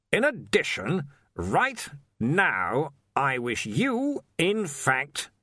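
slow, pointed, strained politeness; through a fixed grin. Acted. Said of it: "slowly and carefully through his fixed grin".

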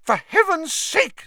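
very high, loud frantic cry; hysterical. Acted. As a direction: shriek it in exasperation. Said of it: "said Madame Pumphrey hysterically".

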